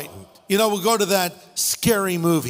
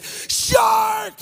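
alarmed shout. Pretend. Helicopter crew warning paddlers, shouting alarm. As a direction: yell it like the alarm it should be. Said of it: "They should have just said".

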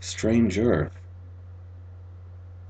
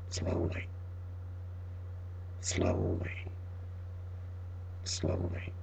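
whispered, slower each time; creeping footsteps told in a fading whisper; 4 semitones lower, 15 dB quieter than usual. A narrator's reading. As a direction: drag each word like a footstep. Footsteps dragging up stairs.

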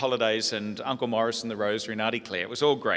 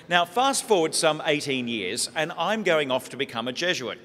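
ordinary talk, nothing marked

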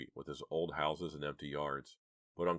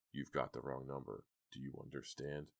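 soft, menacing man's warning; gruff growl; 3 semitones lower, 9 dB quieter than usual. A narrator's reading. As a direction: warn quietly, growing softer. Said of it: "growled the man".

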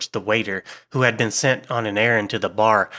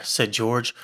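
usual speaking voice

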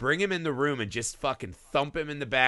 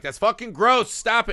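loud, higher mock scolding; mock disgusted yell; 9 semitones higher, 6 dB louder than usual. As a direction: shoo the cat off in disgust. Disgusted owner scolding cat.

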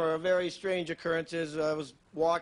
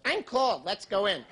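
lightly voiced girlfriend; 3 semitones higher, slower than usual. Girlfriend wanting to quit skiing after ten minutes, mild complaint. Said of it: "She goes".

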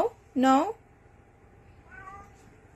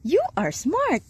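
high sing-song praise; high-pitched pet talk; praising a pet; 8 semitones higher than usual. Real, not acted. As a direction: coo praise at a cat. Praising the cat.

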